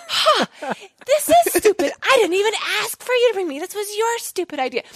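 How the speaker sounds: high, scornful hushed yell; whisper yelling. Retold or imitated. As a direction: fire it right back at him. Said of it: "like, whisper yelling".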